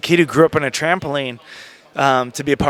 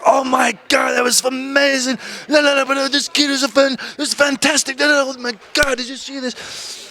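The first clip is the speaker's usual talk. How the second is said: high, excited gushing shout, much higher than own voice; Pat Patterson; 11 semitones higher than usual. Retold or imitated. Backstage boss raving to the office about the elimination, high excited shout. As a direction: gush like a thrilled old wrestling boss. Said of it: "Pat Patterson. He was like".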